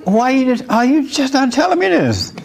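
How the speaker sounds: high, frantic disbelief; sweating groom at the altar, high panicked pleading; nervous groom. Retold or imitated. Hammed-up nervous groom. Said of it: "He was sweating and nervous, like".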